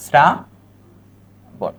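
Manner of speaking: very slow, with a long break inside Shrabon; syllable by syllable. Shown as a demonstration. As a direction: sound out Shrabon in separate pieces. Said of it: "এখানে কি হবে?".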